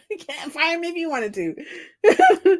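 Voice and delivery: high, drawn-out words through laughter; real giggling fit while talking; helpless laughing. Real, not acted. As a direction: crack up slowly over the whole line. Laughs at own situation.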